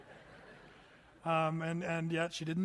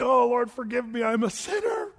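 repentant sinner; 4 semitones higher, 6 dB louder than usual. Retold or imitated. Sinner beating his chest.

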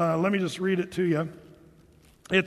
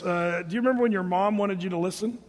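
ordinary talk, nothing marked

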